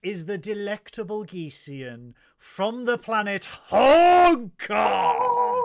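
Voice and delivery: hype introduction. Acted. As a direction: build to a game-show shout. Hyped guest introduction.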